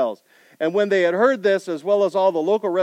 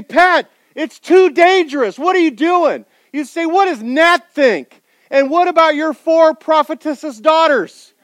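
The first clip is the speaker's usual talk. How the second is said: pleading friend; 10 semitones higher, 10 dB louder than usual. Acted. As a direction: plead with him to stay home.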